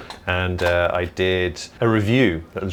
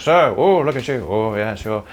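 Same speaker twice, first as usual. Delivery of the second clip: Eddie Izzard impression; 6 semitones higher, 4 dB louder, slower than usual. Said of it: "when Eddie Izzard does it, he just goes".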